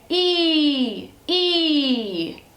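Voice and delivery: put-on bright vowel demo for a toddler. Shown as a demonstration. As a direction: model "ee" clearly for the baby to copy. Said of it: "The E sound is like this".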